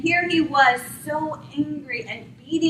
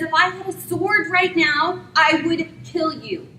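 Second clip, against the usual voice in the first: angry threat; 6 semitones higher, 4 dB louder than usual. Acted. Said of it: "Balaam is so angry at this donkey".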